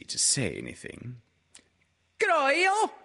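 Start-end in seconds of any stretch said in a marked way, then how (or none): 2.2-2.9 put-on very high, loud squeaky child voice; a tiny joey piping up; Roo's squeak. A narrator's reading. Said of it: "said Roo".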